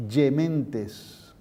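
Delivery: palatal G in "Gementes"; church Latin. Shown as a demonstration. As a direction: Roman "Gementes". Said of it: "La G ahora se pronuncia Y".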